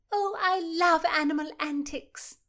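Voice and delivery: Mary burbling with joy at the pig. Acted. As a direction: gush over the animals. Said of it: "Mary burbled".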